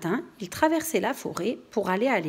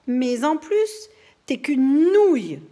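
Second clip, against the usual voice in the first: slow, loud, higher mocking jibe; scornful insult; 6 semitones higher, 7 dB louder, slower than usual. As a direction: drag out the insult with disdain. Julie insulting Jules.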